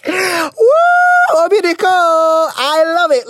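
very high, drawn-out delighted wail; gleeful whoop. Real, not acted. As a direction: whoop and stretch the words with glee.

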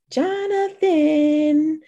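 high, slow, drawn-out sing-song call; annoying sing-song. Acted. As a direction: sing his name out annoyingly, stretched high. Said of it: "said in an annoying sing-song voice".

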